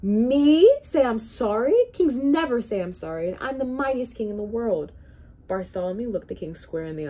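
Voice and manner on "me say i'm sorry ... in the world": much higher and far louder royal outrage; indignant bellow. Acted. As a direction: roar it, offended to the core. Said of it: "he bellowed".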